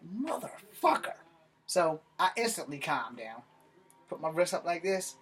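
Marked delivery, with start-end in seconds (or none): 0.0-1.1 drawn-out, high-pitched curse; exasperated disbelief. Retold or imitated. Man learning the van isn't his cousin's, exasperated disbelief.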